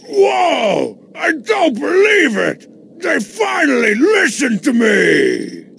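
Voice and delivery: high thrilled shouting; overjoyed outburst; amazed. Acted. Amazed at new graphics.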